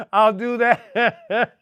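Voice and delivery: slow words through laughter; laughing. Real, not acted. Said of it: "Now I'm gonna have a laugh attack".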